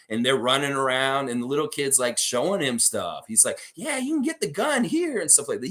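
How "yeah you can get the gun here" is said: high-pitched Southern kid imitation. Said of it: "He had the most hick accent".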